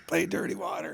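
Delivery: high, strained mock demand; belligerent heckler. Drunken-style bar request to a cover band, mock belligerent demand. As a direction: imitate an insistent heckler without full volume. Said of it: "he was getting belligerent".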